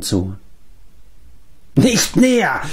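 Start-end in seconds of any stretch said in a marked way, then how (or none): 1.8-2.6 high, crazed screech; screeched warning. A narrator's reading. Mark warning the companions off, screeching. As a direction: screech to keep them back. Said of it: "kreischte Mark".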